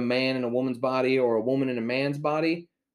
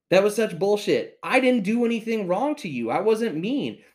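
high-pitched, heated complaint; indignant protest; 7 semitones higher, 3 dB louder than usual. Real, not acted. Storyteller protesting to the absent clerk, heated and indignant. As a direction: protest the injustice, pitched right up.

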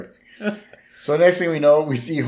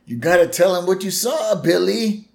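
a touch of Dirty Harry, pitched up; lightly voiced tough cop; 7 semitones higher than usual. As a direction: press the witness like a movie detective. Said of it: "It's very Clint Eastwood".

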